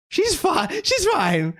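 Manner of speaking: she's very high and rising; mock-cheery squeak; squeaky high reassurance. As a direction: pitch She's way up, then rise.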